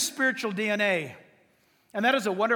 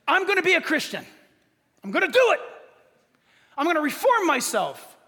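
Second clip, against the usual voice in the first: mock resolve; 5 semitones higher than usual.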